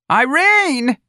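high, loud scream of a name. Acted. Santa greeting her guest at the door with a scream. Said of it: "Santa screamed".

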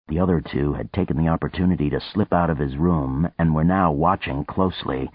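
usual speaking voice

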